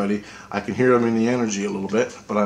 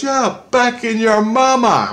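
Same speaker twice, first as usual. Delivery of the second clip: very high, louder and slow; teasing Christ; 12 semitones higher, 6 dB louder, slower than usual. Retold or imitated. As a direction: drawl it out high and mocking. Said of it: "he goes".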